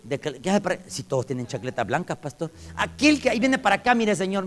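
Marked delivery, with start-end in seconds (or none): 2.8-4.4 high, loud alarmed appeal; acted-out anxious call to a guard; urgent pointing-out. Preacher warning the custodian about the inmate, urgent appeal.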